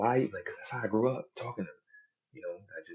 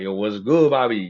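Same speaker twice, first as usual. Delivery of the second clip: put-on loud street greeting, Spanish-neighborhood accent; 3 semitones higher, 9 dB louder than usual. Greeting a Spanish dude, put-on Spanish-neighborhood accent. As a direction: greet him in imitated Spanish-neighborhood slang. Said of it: "if I get around a Spanish dude".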